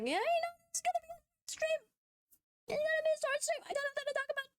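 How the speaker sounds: put-on very high, cutesy girly voice; squeaky e-girl pitch. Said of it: "I have to use the e-girl voice again".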